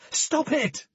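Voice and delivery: loud, high shout; desperate shout. A narrator's reading. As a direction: scream at them to stop. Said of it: "shouted Jane".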